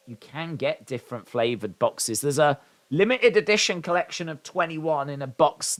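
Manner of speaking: ordinary talk, nothing marked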